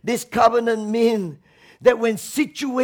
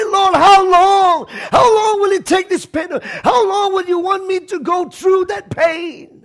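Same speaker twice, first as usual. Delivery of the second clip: pleading cry; 6 semitones higher, 4 dB louder than usual. Retold or imitated. Preacher voicing believers crying to God in pain. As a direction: drag out the plea in pain. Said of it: "We say".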